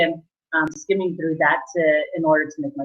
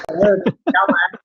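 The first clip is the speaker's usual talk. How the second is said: formal, retold phone answer; formal phone greeting; 4 dB louder than usual. Job seeker answering a call at work, formal. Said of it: "I was really formal and kind of quiet".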